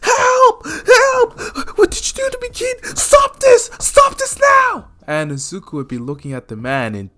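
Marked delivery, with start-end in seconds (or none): 0.0-4.9 loud, very high shriek; panicked, agonized falsetto yelling; screaming for help. Acted. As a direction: scream as if burning alive. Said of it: "screaming for help".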